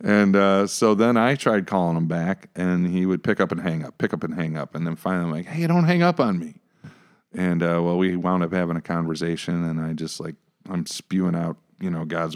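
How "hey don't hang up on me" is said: urgent plea. Retold or imitated. Pleading with caller.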